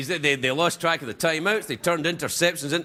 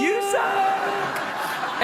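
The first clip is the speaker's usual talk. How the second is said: high mock voice; 13 semitones higher than usual. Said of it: "I suck".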